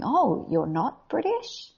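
high, slow mock astonishment; drawn-out, high-pitched disbelief; surprised question. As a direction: imitate someone caught off guard. Acts out someone's surprised discovery.

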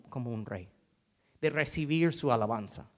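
ordinary talk, nothing marked

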